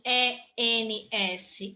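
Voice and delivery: letters E N S spelled slowly, one by one; slow down and spell each letter. Shown as a demonstration. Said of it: "spelling out the ending".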